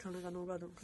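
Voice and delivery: softly sighed mock sadness; quiet, dejected mock lament; mock sad. Real, not acted. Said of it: "悲しい".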